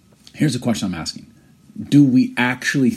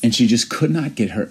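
ordinary talk, nothing marked

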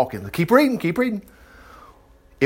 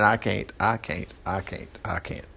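fading lower and softer into resignation; mock defeated; 9 semitones lower, 10 dB quieter than usual. Preacher mimics a believer who keeps giving up, defeated. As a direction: repeat it like giving up, slowly sinking away.

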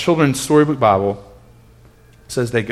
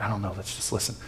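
hushed reply; 3 semitones lower, 15 dB quieter, faster than usual. Retold or imitated. Parent hushing kids during story.

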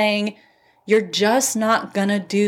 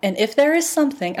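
pitched up high, getting worked up; rising excitement as the point lands; real fired-up pep talk; fired-up yelling; 6 semitones higher than usual. Real, not acted. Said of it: "I'm, like, yelling".

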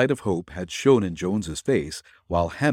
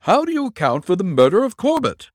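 raised, pressing question; forceful demand; 11 semitones higher, 6 dB louder, faster than usual. A narrator's reading. Superintendent pressing Nick, raised voice. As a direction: demand an answer loudly.

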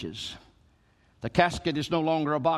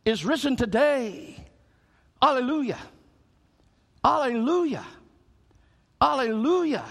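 much higher, ringing exultant cry; preaching climax; 9 semitones higher than usual. Acted. Pastor rousing the congregation to celebrate life, exultant and high. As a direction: lift them up to hallelujah.

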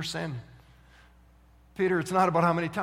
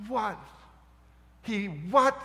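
higher, incredulous questioning; stunned disbelief; 4 semitones higher, much slower than usual. Acted. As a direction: repeat it as if you can't believe it. Stunned listeners react to the outcome.

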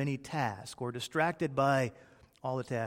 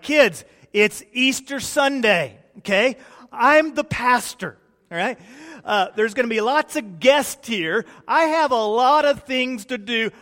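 put-on loud, high, exasperated lecture at the kids; an anxious pastor losing patience before church; frustrated dad; 9 semitones higher, 11 dB louder than usual. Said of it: "I get a little bit frustrated".

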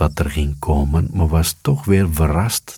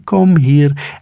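much higher, louder tiny-voiced call; high firefly call; 9 semitones higher, 4 dB louder than usual. A narrator's reading. Fireflies calling each other, high-pitched. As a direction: beckon like a little glowing bug. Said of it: "Dan riepen ze".

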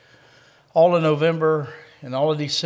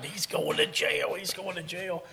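breathy, hushed mock-laughing taunt; imitated laughing; 3 semitones higher, 6 dB quieter, slower than usual. Friends on the phone watching him get in, mock laughing. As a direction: imitate friends cackling through their words. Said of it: "they're laughing".

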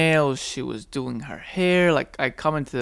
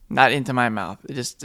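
ordinary talk, nothing marked

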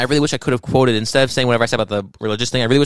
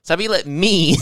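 usual speaking voice

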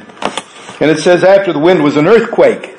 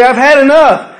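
raised, higher, fed-up cry; exasperated outcry; 9 semitones higher than usual. Acted. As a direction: throw up your hands: I've had enough. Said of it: "you throw your hands up and say".

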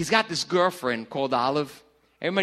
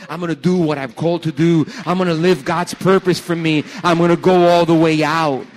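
louder and louder until shouting; preaching at full force; 11 dB louder than usual. Real, not acted. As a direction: build the declaration to a roar. Preacher building to climax.

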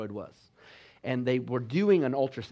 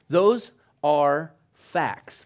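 slow emphasis; 6 dB louder, much slower than usual. Real, not acted. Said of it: "slow emphatic point".

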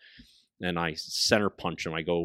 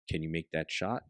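much softer, low murmur; whispered doubt; hushed question; 4 semitones lower, 10 dB quieter than usual. Retold or imitated. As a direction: ask quietly so the deer won't hear. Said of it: "very quickly and very quietly".

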